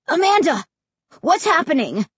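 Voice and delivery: high, anxious calling out; alarmed. A narrator's reading. Frightened brother calls to his sister from the hallway, alarmed. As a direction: demand an answer in rising alarm.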